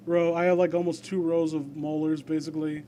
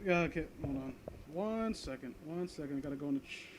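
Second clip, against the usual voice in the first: much softer, half turned from the mic; quiet aside; 3 semitones lower, 18 dB quieter than usual. Real, not acted. Streamer talking off mic to someone at home, very quietly. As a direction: murmur to someone in the room.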